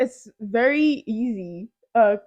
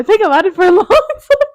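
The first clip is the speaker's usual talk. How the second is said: laughing; 8 semitones higher, 8 dB louder than usual. Real, not acted. Laughing while stalling.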